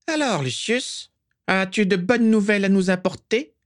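high, sibilant villain voice; high-pitched sinister questioning; Voldemort. A narrator's reading. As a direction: question your follower in a thin, very high voice. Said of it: "la voix aiguë et sifflante de Voldemort".

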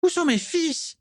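high-pitched anguished moan; plaintive high wail. A narrator's reading. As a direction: moan it like a frantic mother, very high. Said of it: "gémit soudain Ursula Crabbe".

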